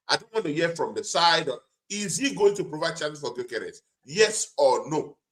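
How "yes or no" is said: ordinary talk, nothing marked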